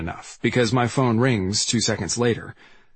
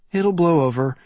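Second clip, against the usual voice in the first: put-on girl's voice; 6 semitones higher, 3 dB louder than usual. A narrator's reading. Branley's quoted line.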